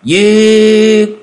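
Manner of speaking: hét lớn, cao vút; gào một tiếng giết; hét vang. A narrator's reading. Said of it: "bỗng hét vang". (tiếng hét vang rền)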